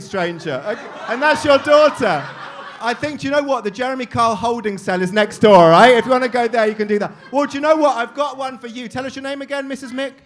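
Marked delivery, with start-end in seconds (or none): none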